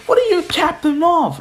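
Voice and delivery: high-pitched mock disbelief; incredulous question. Friends challenging Izuku's hero name, incredulous.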